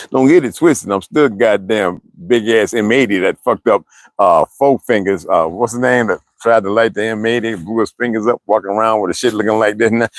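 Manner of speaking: ordinary delivery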